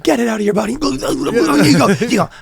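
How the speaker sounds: a hint of a priest casting out; mock exorcism.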